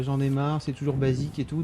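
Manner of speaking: usual speaking voice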